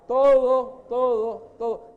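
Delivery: loud, stretched shout of todo; a sudden pulpit shout; shouted everything. Acted. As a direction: cry todo out over the crowd. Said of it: "preacher shouts answer".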